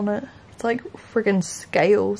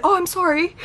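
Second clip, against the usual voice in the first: mock stranger apology; 10 semitones higher than usual.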